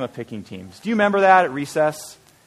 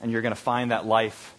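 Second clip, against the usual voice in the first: softer, slow, gentle promise; tender reassurance; 5 semitones lower, 6 dB quieter, slower than usual. Acted. The preacher voicing Jesus to a struggling listener, soft tender reassurance. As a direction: say it softly, like a hand on a shoulder.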